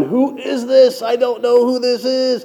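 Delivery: high-pitched, drawn-out mock desperation; puzzled inner panic. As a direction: wail it lightly, as a baffled inner voice. Frantic inner thought while greeting her.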